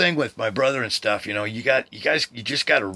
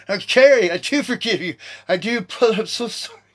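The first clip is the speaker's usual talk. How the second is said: emotional reply; 11 semitones higher, 4 dB louder than usual. Real, not acted. Tearful reply to brother.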